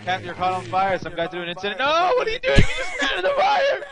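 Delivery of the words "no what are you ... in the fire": high shouting through laughter; laughing shout. Real, not acted. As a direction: yell at him while cracking up. Teammate runs into fire.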